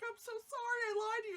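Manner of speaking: high-pitched mock apologetic girl; hammed-up girlish apology. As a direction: imitate a rom-com heroine pleading. Acting the film girl's apology.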